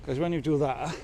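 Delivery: ordinary talk, nothing marked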